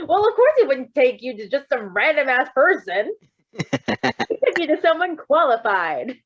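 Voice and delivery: hammed-up doctor, high, loud and slow; mock doctor. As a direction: parody the doctor, drawing it out. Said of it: "It's like".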